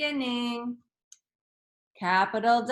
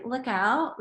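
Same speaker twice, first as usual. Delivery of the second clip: OUT rises into a question; 2 semitones higher than usual. Shown as a demonstration. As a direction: ask it, lifting the pitch on OUT. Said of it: "like a question".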